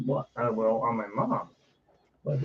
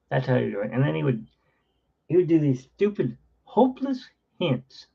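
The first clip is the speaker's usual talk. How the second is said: normal talking voice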